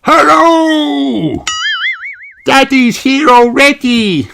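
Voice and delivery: high, loud excited call; cheering pup. A narrator's reading. Danny racing down the hill cheering his daddy. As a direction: shout down the hill with joy. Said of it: "cheers Danny".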